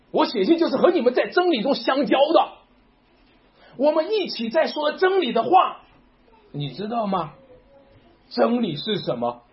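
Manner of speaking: rally the congregation with every question; fervent preaching. Acted. Pastor pressing believers about the Son, preaching at full force.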